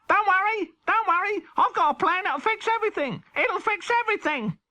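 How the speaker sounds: very high, quick rabbit voice; Chris Rabbit voice. Acted. Chris Rabbit reassures Henry's cat, very high and quick. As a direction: chirp it like an eager cartoon rabbit.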